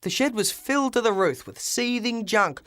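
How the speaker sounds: usual speaking voice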